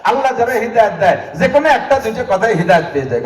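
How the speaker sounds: fast explanatory translation; quick gloss. Acted. Preacher rushing through the verse's meaning, fast.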